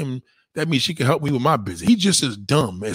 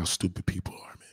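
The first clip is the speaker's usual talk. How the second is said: weary whisper; 7 semitones lower, 12 dB quieter than usual. Real, not acted. Catching breath after rant.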